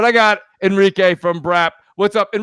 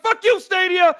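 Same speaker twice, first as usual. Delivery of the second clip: mock angry shout at Stadia, pitched up; angry yell; 8 semitones higher, slower than usual. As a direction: yell it like a fed-up gamer blaming Stadia. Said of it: "someone's like".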